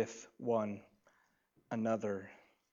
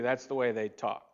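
normal talking voice

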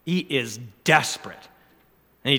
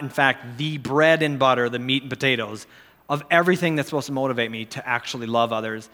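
ordinary talk

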